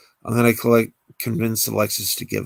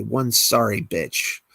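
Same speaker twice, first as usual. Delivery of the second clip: ordinary delivery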